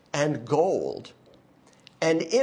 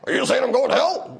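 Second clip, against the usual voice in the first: higher, louder Larry King voice, interviewer's gotcha; Larry King impression; 10 semitones higher, 4 dB louder, faster than usual. Preacher doing Larry King's gotcha question to Christians, hammed-up. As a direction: lean in like Larry King springing the trap. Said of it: "that was my Larry King impression".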